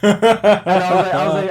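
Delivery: laughing so hard the words barely come out. Real, not acted. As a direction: crack up mid-sentence while telling it. Laughing while retelling.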